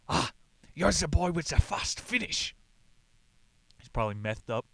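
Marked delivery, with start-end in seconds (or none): 0.1-2.6 higher, louder hammed-up dictator voice; mock Hitler. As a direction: play a pompous dictator praising a runner. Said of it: "Hitler says to Louis".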